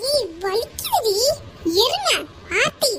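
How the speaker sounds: high-pitched protest through pain; pained, indignant squeal. Acted. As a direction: yelp at someone to let go. Grabbed and hurting, demands release.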